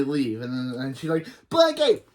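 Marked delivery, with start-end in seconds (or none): 1.5-2.0 short, high, loud shriek; tantrum shriek. Acted. Khloe interrupting Hank for her blankie, high shriek. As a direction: burst out like a raging toddler.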